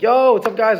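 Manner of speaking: hyped shout to the guys; excited greeting. Retold or imitated. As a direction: call out to your friends, pumped. Greeting the boys at ringside.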